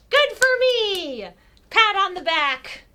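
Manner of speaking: high, loud, slow cheer; triumphant self-congratulation. Acted. Character free of Mister Hamlin, triumphant self-congratulation. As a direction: draw out each word proudly.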